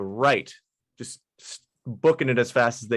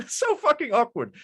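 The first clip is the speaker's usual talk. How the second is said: much higher squeak of awkwardness; squeaky awkward; 12 semitones higher than usual. Acted. Elf officer leaves awkward scene, squeaky mock cringe. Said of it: "looks at you and goes".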